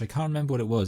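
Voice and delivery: normal talking voice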